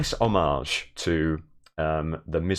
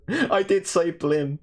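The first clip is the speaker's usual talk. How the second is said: slow, much higher mock-horrified "I did say blim"; mock dramatic confession; 10 semitones higher, much slower than usual. Shown as a demonstration. Streamer to his chat, mock-horrified and slow. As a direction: drag out "I did say blim" in high, theatrical dismay. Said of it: "the fact that [name] said, [name] said blim".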